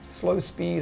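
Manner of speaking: normal talking voice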